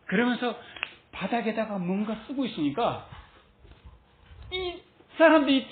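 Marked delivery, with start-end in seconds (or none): none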